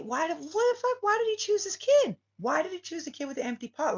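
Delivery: high, loud squealing protest; mock crowd outrage; outraged disbelief. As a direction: shriek at the unfair choice.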